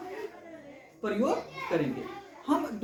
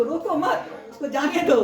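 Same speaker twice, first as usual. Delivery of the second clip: higher "रोको मत", then a loud "जाने दो"; break after मत; 6 semitones higher, 6 dB louder than usual. Shown as a demonstration. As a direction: insist on letting go, with "मत" tied to "रोको". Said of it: "दूसरा वाक्य कह रहा है". ("रोको मत" जोड़कर, ऊँची आवाज़ में "जाने दो")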